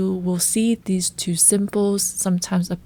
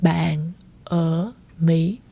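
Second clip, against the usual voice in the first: bạn ở Mỹ drawn out slowly; slow and clear bạn ở Mỹ; slow demo; 3 semitones lower, much slower than usual. Shown as a demonstration. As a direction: spell out bạn ở Mỹ word by word. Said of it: "So you can say it as".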